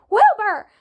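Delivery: high, loud shouted name. A narrator's reading. Tubbo recognizes the voice on the radio, shouting. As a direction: call the name out sharply. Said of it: "shouted, gasping again".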